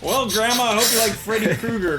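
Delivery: high, loud teasing voice; mock taunt. Teasing Grandma about Freddy Krueger, high loud mock voice. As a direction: taunt Grandma with the bad news.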